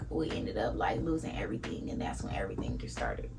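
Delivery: soft whisper, voice dropped away; whispered memory. Real, not acted. Telling viewers how the family lost everything, whispered. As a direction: let it sink into a pained whisper. Recalling losing everything as a child.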